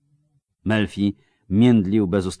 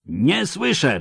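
roar; 10 semitones higher, slower than usual. Acted. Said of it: "ryknął".

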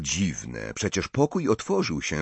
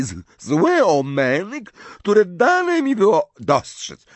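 much higher, louder, slower voice for the eccentric seer; high, slow voice of an eccentric old prophetess; Professor Trelawney; 10 semitones higher, 5 dB louder, slower than usual. Acted. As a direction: play the tipsy, offended seer, pitched way up. Trelawney repeats her omens.

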